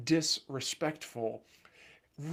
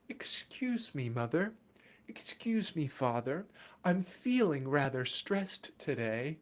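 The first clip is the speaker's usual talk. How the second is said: put-on overly polite child; 4 dB quieter than usual. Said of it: "look at you and say".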